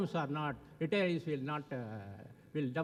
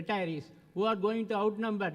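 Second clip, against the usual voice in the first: normal talking voice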